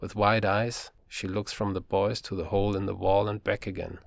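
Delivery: usual speaking voice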